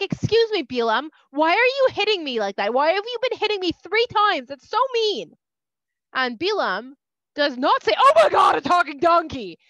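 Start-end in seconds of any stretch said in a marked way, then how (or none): 0.0-5.3 high, loud, offended donkey voice; donkey complaining to Bilam, high and loud; talking donkey. Acted. 8.0-9.6 astonished. Pretend. Hypothetical astonished reaction.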